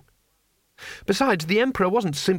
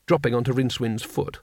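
plain reading voice

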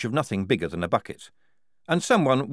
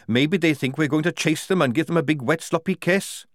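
higher, mocking woman soldier voice; sarcastic Tonker; 6 semitones higher, 3 dB louder than usual. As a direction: scoff at the enemy's fear. Tonker mocks the enemy.

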